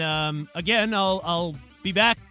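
ordinary talk, nothing marked

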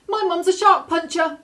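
high, loud panicked shout at the shark; a girl shouting at a shark for protection. A narrator's reading. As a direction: yell it out, eyes squeezed shut. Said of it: "the girl shouted".

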